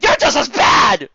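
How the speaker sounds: high, loud, drawn-out shout; accusing shout. Acted. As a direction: yell the accusation. Accusing the narrator.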